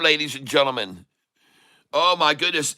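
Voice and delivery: ordinary talk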